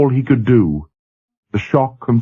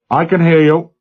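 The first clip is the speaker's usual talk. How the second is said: louder, raised reply; loud over amplifier; 8 semitones higher, 4 dB louder than usual. A narrator's reading. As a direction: call back loudly into the speaker. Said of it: "echoed loudly over the amplifier".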